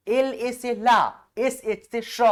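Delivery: letters spelled out slowly one by one; slow dictation to students; slow spelling. Shown as a demonstration. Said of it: "spelling letters out for students".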